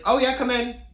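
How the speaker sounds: high-pitched mock welcome; friendly roommate imitation.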